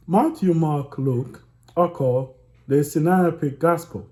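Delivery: loud, steady read-aloud voice. A narrator's reading. Reading a disputed book to viewers, loud read-aloud voice. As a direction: project the text, easing off toward the end.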